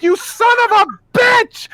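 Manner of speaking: hammed-up yell at the screen; mock outraged yell. To the filmmakers as they cut away, a mock furious yell. Reliving anger at the cutaway.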